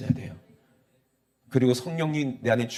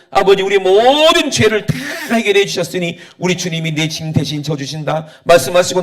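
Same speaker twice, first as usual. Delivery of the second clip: humble prayer; 6 semitones higher, 9 dB louder than usual. Acted. Quiet confessional prayer.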